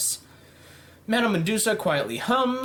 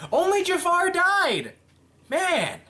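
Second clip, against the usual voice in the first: exasperated outburst; 11 semitones higher, slower than usual. Real, not acted. Disappointed at low kill count.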